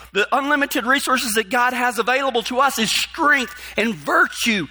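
high, fervent preacher voice; preaching. Acted. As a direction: preach it out to the congregation. Preaches sermon at full force.

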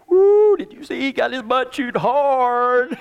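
slow, hooting mockery; teasing cowboys. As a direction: gossip with relish about the scolding. Cowboys mocking him behind his back.

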